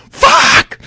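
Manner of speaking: very high, loud scream; sudden involuntary yell, re-enacted. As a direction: scream it out in shocked excitement. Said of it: "and I scream".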